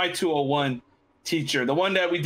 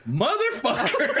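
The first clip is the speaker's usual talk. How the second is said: higher, laughing realization; laughing curse; 7 semitones higher than usual. Retold or imitated. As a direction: curse as it finally clicks, cracking up. Teacher realizes April Fools prank.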